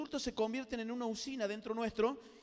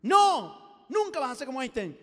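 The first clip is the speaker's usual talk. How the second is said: shouted denial; 2 semitones higher, 14 dB louder, slower than usual. Acted. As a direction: burst out to crush the fantasy. Insulter yells back. (negativa a los gritos)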